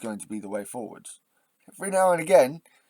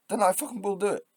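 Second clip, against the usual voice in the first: defiant anger; 9 semitones higher, faster than usual. Real, not acted. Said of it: "why I get so angry".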